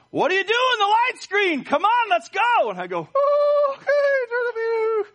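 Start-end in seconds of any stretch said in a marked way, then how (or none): 0.1-2.7 very high, loud mock yelling; children mimicking Daddy. Children repeating what they heard Daddy say, high mimicked shouting. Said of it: "They'll say something like this". 3.1-5.1 very high, loud strained cry; hammed-up high-pitched reaction; mock dismay. Dad hearing his children mimic him, hammed-up high dismay.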